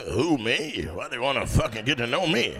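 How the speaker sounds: low, surly smoker voice; imitated don't-care smoker, sinking very low; smoker woman. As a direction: grumble like a fed-up older smoker. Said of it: "Stop smoking".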